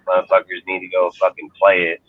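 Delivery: ordinary delivery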